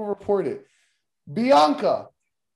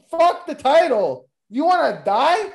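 slow, loud, higher hammed-up yelling; mock threatening shout; 6 semitones higher, 3 dB louder than usual. Podcaster shouting a mock threat at a wrestler. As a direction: yell it like a mock heel promo.

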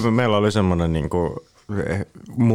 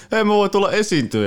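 mock eager roommate; 14 semitones higher than usual. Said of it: "Se on se".

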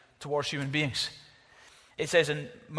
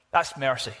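normal talking voice

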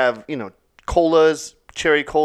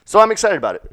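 excited; 7 semitones higher, 8 dB louder, faster than usual. Real, not acted. Repeated, first loud.

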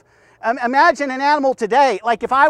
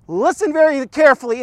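ordinary talk

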